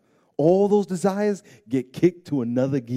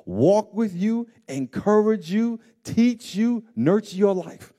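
higher, louder preacher's cry to the congregation; fervent preaching; 7 semitones higher, 4 dB louder than usual. Acted. Pastor urging the congregation that God pleads, fervent preaching. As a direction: preach it out with rising fervor.

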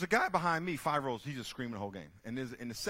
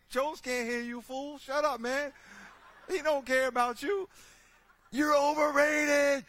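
mock shouted retort; 14 semitones higher, 10 dB louder, slower than usual. Voicing fan yelling back.

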